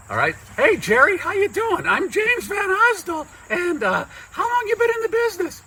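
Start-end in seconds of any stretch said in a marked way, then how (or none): 0.6-4.0 very high, squeaky puppet-like voice; high-pitched comic character greeting; botched guest voice. Acted. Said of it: "No, wait, that's your voice". 4.3-5.6 very high, quick squeaky voice; high-pitched comic character question; botched guest voice. Acted. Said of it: "No, wait, that's your voice".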